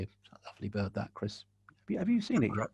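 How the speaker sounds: teasing. Retold or imitated. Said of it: "remind him of that and say".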